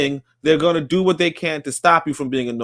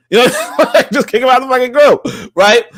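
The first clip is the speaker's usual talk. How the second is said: high, loud, laughing through the words; laughing outburst; 8 semitones higher, 5 dB louder than usual. Real, not acted. Venting to his audience about a troll, loud and laughing. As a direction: burst out laughing as you say it. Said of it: "I just wanna just kick him out".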